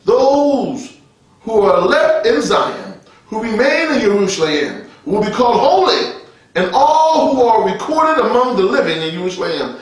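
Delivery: slow, emphatic Bible reading; declaimed scripture. Real, not acted. Preacher reading Isaiah to the congregation, declaimed scripture. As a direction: proclaim the verse, drawing out key words.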